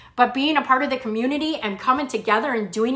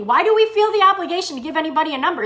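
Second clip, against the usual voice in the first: ordinary talk, nothing marked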